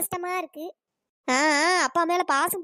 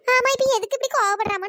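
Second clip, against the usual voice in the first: a higher, louder offended comeback; indignant retort; 6 semitones higher, 5 dB louder than usual. Acted. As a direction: snap back at the mother for scolding. Daughter retorts to scolding mother. (கோபமான பதிலடி)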